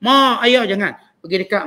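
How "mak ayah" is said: loud, raised call to parents; shouting from afar. Shown as a demonstration. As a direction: holler for mum and dad from far away. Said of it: "kita tak jerit jauh".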